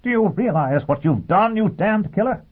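high-pitched outraged shout; appalled, strained accusation; Dagenham shouting. A narrator's reading. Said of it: "Dagenham shouted".

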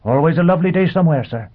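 high, quick, beaming robot voice; a machine grinning as it speaks. Acted. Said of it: "the robot beamed".

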